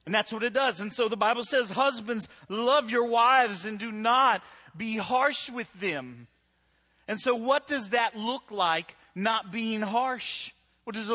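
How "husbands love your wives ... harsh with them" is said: scripture proclaimed. Acted. Scripture quoted loudly to crowd.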